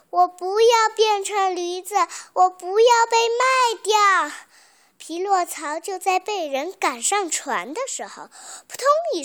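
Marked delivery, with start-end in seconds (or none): 0.1-4.4 high, loud protesting cry; loud shout. A narrator's reading. Boy refuses to be sold as a donkey, shouting loudly. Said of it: "大声地喊道".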